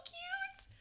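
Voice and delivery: high, soft squeal of adoration; drawn-out high coo; gushing over cuteness. Real, not acted. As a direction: melt with affection, voice rising up high.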